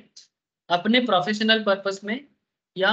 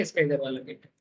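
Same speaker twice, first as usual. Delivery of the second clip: quiet repeat; 6 semitones lower, 5 dB quieter than usual. Acted. Teacher repeats the gate remark to himself, low and soft. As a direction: lower and softer, stress on इसका.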